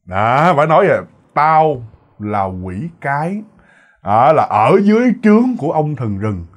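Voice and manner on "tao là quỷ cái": ordinary delivery, nothing marked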